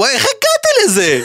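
high mock wail at himself; mock despair. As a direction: wail it in mock self-pity. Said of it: "ואני אומר". (יללה מבוימת בקול גבוה)

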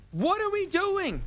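high, drawn-out exasperated question; incredulous. Real, not acted. Radio host appalled that people donated to a celebrity's fundraiser, incredulous. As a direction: pitch it way up in disbelief.

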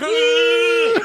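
high, loud laughing yell; mock yell. Comic retelling hanging out the train, yelling. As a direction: yelp as if hung out a train window. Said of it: "I'm like".

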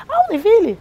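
loud, high-pitched acted surprise; sudden recognition. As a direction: pitch leaps up as the name clicks. Porter suddenly recognises the name.

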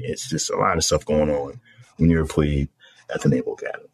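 ordinary talk

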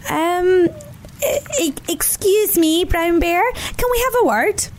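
high, stammering timid voices; nervous bunnies. Acted. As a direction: call into the cave, scared. Nervous bunnies call bear.

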